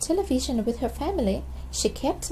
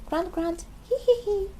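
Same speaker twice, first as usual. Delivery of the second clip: grunting giggle; 9 semitones higher than usual. A narrator's reading. Family goodnight noises.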